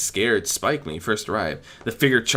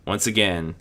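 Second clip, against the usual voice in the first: ordinary delivery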